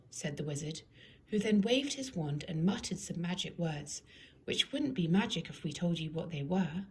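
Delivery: usual speaking voice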